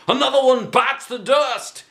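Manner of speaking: high gleeful gloat. Acted. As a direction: rub it in. Teacher gloating.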